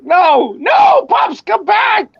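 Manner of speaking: high pleading shout; mock desperate pleading. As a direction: plead him back from dying, hammed up. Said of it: "I'm like".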